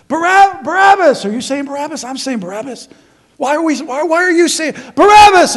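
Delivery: a confused man in the crowd losing his patience; confused crowd member. Retold or imitated. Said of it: "who said what".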